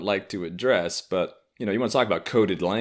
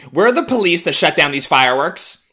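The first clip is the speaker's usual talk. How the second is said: mock liberal; 9 semitones higher, 9 dB louder than usual. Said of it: "are now being like".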